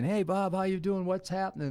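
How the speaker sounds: high-pitched, chatty mock greeting; casual guy greeting. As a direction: imitate an everyday guy saying hello. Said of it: "I'm used to a guy saying".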